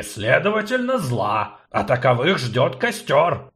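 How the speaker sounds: indignant dwarf report; agitated gnome, loud. Acted. Gnome briefs sorceress on witch hunt, agitated.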